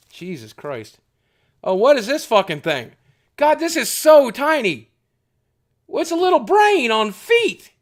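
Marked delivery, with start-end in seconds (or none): 1.6-7.7 surprised. Real, not acted. Surprised at a tiny figure.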